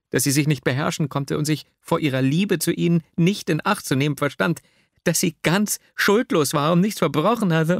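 high, increasingly agitated heroine; reproachful Nastjenka. A narrator's reading. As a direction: plead her innocence, climbing to a distressed exclamation. Reads the heroine's tearful reproach. (vorwurfsvoll, zunehmend verzweifelt)

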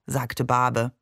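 ordinary delivery, nothing marked

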